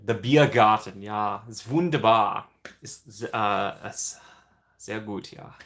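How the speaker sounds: mock German accent, savoring the words; playful German accent. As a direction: put on a hammed-up German accent. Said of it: "The German pavilion".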